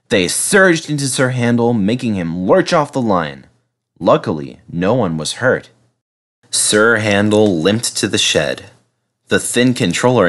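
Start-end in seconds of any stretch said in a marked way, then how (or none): none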